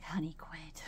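mock whisper of the husband. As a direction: hush it like he's asking her to stop. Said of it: "he's like".

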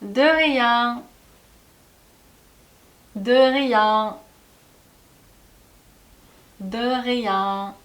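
de rien with nasal 앙 at the end, gaps to echo; teacher models de rien for the class; model for repeating. Shown as a demonstration. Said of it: "이거 한번 따라해 볼게요".

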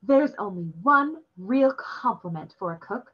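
lightly voiced sweet hostess, slowing; a gracious cook pleased with her guests; kind old demoness. A narrator's reading. Demoness praising the boy for eating everything, sweet and gracious.

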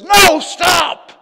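sudden loud, high acted command; shouted warning. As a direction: shout it like an urgent inner alarm. Acts Holy Spirit's warning.

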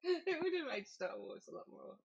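laughing through the words, rising high; words stretched out by giggles. Real, not acted. Joking after funny impression.

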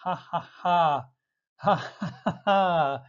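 a few staged ha-ha laughs; mock laughter. Shown as a demonstration. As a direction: act out a hearty laugh. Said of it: "who love to laugh".

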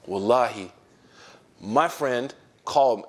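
ordinary talk, nothing marked

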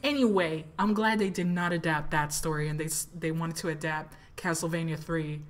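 usual speaking voice

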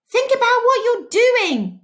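high pleading cry; a teacher pleading with the mob, high and loud. Acted. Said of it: "cried Miss Catherine".

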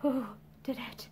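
very low-volume, strained question; hushed, dreadful, barely voiced; terrible, struggling voice. A narrator's reading. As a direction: force it up from the depths of hell. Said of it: "in a terrible voice that sounded".

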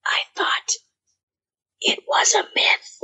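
hushed, breathless whisper; husky low whisper. Retold or imitated. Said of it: "saying in a husky low voice".

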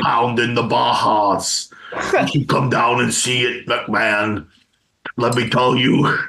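put-on wrestler-turned-governor voice; Jesse Ventura. Host as ex-governor inviting McMahon to his compound, impersonated. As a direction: address a rival in a mock ex-wrestler's voice. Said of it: "Ventura is his own kettle of fish".

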